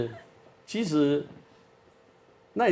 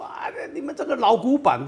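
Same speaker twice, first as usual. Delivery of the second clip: higher, mocking teenage retort; talking back; 9 semitones higher than usual. Son mocking his strict father as old-fashioned, cheeky retort. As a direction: snap back at dad like a cheeky kid. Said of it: "所以他就顶嘴说".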